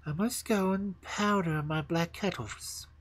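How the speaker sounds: a hint of a haughty queen; snow queen voice. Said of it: "said the Snow Queen".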